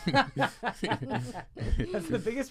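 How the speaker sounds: laughing. Real, not acted. Laughing at the impression.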